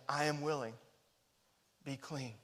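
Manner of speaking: soft, gentle answer of Jesus; hushed, tender healing words; tender reassurance. Retold or imitated. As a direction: speak it like a quiet blessing.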